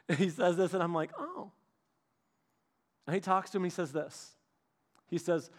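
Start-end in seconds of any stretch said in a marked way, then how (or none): 1.2-1.5 small surprise. Retold or imitated. Kid reacts to dad's answer.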